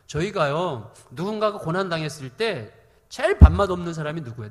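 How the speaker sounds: usual speaking voice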